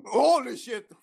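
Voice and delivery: startled yelling, high and loud; real fright shout; scared horror game streamer. Real, not acted. Jump scare hits.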